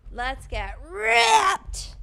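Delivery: slow drawn-out high shout; catchphrase shout. Acted. Streamer belting her catchphrase to chat, slow high shout. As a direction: belt out the signature line slowly.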